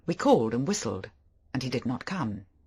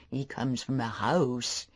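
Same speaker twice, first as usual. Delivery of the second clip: slow simple fisherman; slower than usual. A narrator's reading. The idiot fisherman greeting the stranger, slow and simple. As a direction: drag the words out like a simple old digger.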